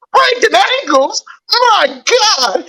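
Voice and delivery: high-pitched excited exclamation; excited hype. Real, not acted. Fan celebrating his team breaking ankles, excited.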